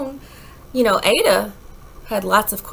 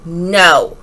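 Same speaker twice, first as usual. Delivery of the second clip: stern no; 5 semitones lower, 9 dB louder than usual. A narrator's reading. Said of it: "Her mother said".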